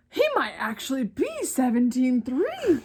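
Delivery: put-on cowboy accent. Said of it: "I'm not making the accent up".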